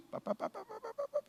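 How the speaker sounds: high, soft rapid pá pá pá; imitated tapping sound. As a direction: tap it out lightly and quickly, high up. Imitating the water trick sound. (sons de batidinha imitados, agudos e baixinhos)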